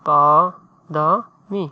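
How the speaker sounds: পা-দা-মি split into slow separate syllables. Shown as a demonstration. Tutor sounding out a Bengali word, slow. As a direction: break পা-দা-মি into three slow beats. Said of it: "sorry".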